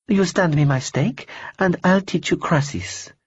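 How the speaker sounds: a cool, polite stranger brushing him off; lightly voiced woman. Woman character voice.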